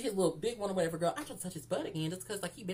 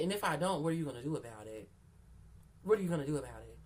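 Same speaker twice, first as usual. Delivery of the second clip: defiant inner voice; 4 semitones lower, faster than usual. Retold or imitated. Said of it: "I'm thinking in my head".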